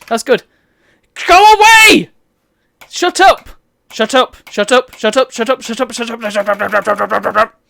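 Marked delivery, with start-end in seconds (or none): 1.3-2.1 high furious yell; angry shout. Real, not acted. Gamer yelling at nagging advisors, high angry shout. 2.9-7.6 rapid angry shut up chant; repeated shout. Real, not acted. Gamer shouting down chattering advisors, rapid angry chant.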